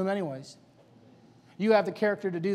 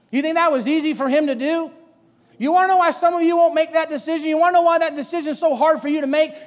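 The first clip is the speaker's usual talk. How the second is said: high, loud sermon delivery; full-force preaching; 8 semitones higher, 9 dB louder than usual. Real, not acted. Preacher challenging congregation about Samuel's submission, full-force preaching. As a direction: drive each question at the congregation.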